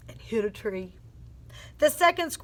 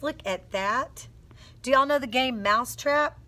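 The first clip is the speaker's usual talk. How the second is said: plain reading voice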